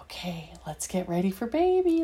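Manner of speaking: ordinary delivery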